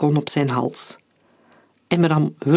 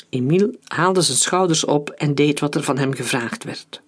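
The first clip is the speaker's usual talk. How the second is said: plain reading voice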